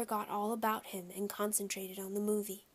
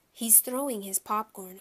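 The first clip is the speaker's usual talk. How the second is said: ordinary delivery, nothing marked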